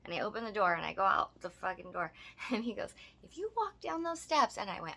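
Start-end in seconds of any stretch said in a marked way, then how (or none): 3.2-4.6 high-pitched, hammed-up warning; mock threat. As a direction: mimic a dramatic ultimatum up high. Mimics man's escalating threat.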